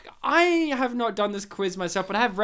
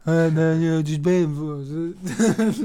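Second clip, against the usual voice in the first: low, slow mock Italian New Yorker; Nicolas Cage accent; 4 semitones lower, slower than usual. Host imagining Nicolas Cage in the Italian New Yorker accent. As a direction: lightly drawl it like a tough guy. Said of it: "he might use this accent".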